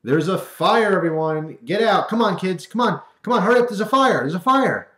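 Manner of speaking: mock panic, top of the lungs; yelling fire. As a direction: shout fire to a classroom. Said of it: "you yell at the top of your lungs".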